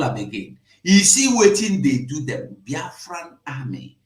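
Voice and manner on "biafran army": 1 whisper. Real, not acted. Hushed aside about the army.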